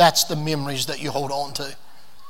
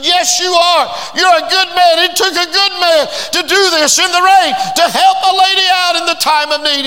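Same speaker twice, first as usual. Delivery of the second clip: high, loud, shouted praise; shouting to convince a doubting man; fervent insistence; 11 semitones higher, 6 dB louder than usual. Acted. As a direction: proclaim it like a revival preacher. Woman insisting the man is good.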